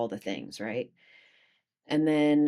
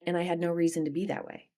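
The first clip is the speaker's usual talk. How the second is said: ordinary talk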